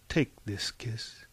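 slow, soft whisper; intimate whisper. A narrator's reading. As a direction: whisper it tenderly to one listener. Intimate address to the reader.

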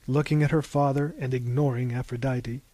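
ordinary delivery

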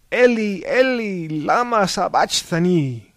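high, loud cry to heaven. A narrator's reading. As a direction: call out to God with all your strength. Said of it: "lifted his head and cried out".

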